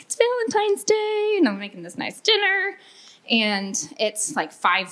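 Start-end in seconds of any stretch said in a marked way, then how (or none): 0.0-2.8 mock sing-song. Wife mimics herself cooking Valentine's dinner, high sing-song. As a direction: play up the cheery homemaker voice, way up high.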